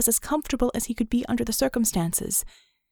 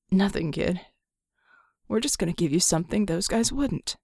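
a hint of a lower, battered genie voice; wry, worn-out grumble; lightly voiced Red; 3 semitones lower than usual. Battered genie Red joking about his wounds, lower. Red's voice in story.

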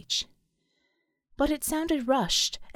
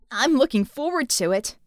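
mildly raised, cheeky shout; 4 dB louder than usual. A narrator's reading. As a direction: call back cheekily. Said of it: "the masked girl shouted".

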